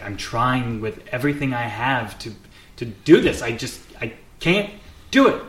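straining, pitch leaping at the breaking point; frustrated outburst, punched loud on the last words; halting, worked-up protest; exasperated. Acted. Burt frustrated in acted training scene.

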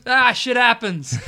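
drawn-out, high, loud comic shrug; loud brush-off. Acted. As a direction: imitate a shooter brushing it off, laughing. Acted shooter's dismissive reply.

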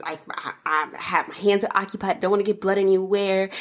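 normal talking voice

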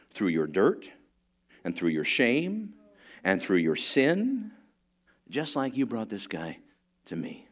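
tender Jesus. Acted. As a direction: speak it like a loving promise. Jesus speaking tenderly to friends.

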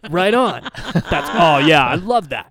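laid-back surfer dude drawl; slow chill surfer bro; Keanu Reeves surfer voice. Retold or imitated. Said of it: "Keanu Reeves kinda surfer voice".

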